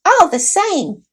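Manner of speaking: high squeaky voice of a dignified little mouse; a tiny knight, formal and put out; proud mouse knight. A narrator's reading.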